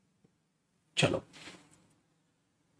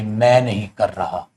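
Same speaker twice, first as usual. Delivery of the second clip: flat refusal; 6 semitones lower, 3 dB quieter than usual. Shown as a demonstration. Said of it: "कुछ करने से मना कर दे".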